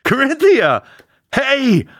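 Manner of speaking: high, loud delighted calls; excited greeting. Acted. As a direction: call out to an old friend in delight. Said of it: "Corinthia Fox".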